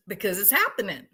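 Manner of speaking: ordinary talk, nothing marked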